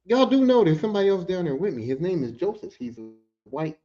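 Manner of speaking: ordinary talk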